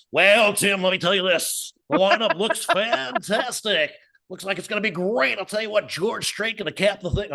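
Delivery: high, put-on radio announcer; cheesy announcer voice. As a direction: ham up the DJ voice. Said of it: "What's that voice?".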